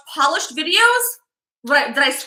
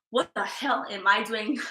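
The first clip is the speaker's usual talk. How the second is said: self-mocking mutter; 4 semitones lower, 9 dB quieter than usual. Real, not acted. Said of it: "I make fun of myself".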